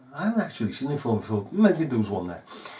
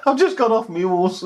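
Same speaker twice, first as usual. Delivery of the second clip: high, laughing mock officer; a hint of a pompous copper; 7 semitones higher, 3 dB louder than usual. Voicing padded officer, laughing.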